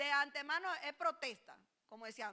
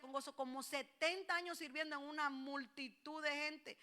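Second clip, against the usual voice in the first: ordinary talk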